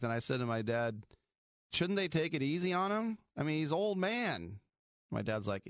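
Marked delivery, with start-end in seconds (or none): 1.7-4.6 put-on young self asking. Child voice asking dad.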